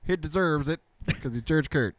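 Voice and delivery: put-on mumbling Texas Southerner accent; mock mumbling accent. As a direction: keep up the lazy Texas mumble on the name. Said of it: "I have trouble not talking like that".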